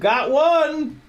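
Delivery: sudden high yelp of success; excited. Real, not acted. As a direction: burst out in triumph. Part finally fits.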